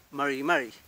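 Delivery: MARI MARI with the Mapuche r, not a Spanish one; Mapuche pronunciation. Shown as a demonstration. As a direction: demonstrate MARI MARI with an untrilled, English-like r. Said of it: "lo que importa es la pronunciación".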